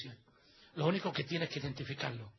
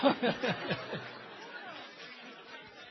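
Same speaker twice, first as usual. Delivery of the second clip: long, breathless laugh trailing off; real laughter; 6 semitones higher, 3 dB quieter than usual. Real, not acted. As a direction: crack up at your own joke. Laughs after the joke.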